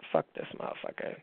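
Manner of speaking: whispered under the breath; a fed-up whispered curse; inner queen whisper. Retold or imitated. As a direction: hiss it to yourself like a secret. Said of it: "the queen in me comes out and is like".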